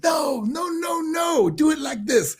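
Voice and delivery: high, excited mock cry; imitating Dusty Rhodes. Mentor stopping the rookie to show him how. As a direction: cut in high and eager like the mentor. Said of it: "He goes".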